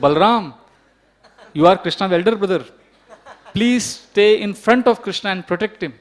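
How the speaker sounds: acted-out projected plea; calling out instructions. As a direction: imitate an anxious mother instructing the older boy. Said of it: "Then she would call Balram".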